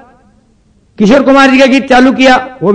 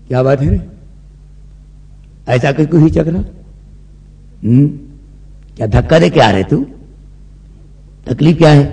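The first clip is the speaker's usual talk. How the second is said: gruff doctor; 6 semitones lower than usual. Acted. Mechanic-doctor questioning patient.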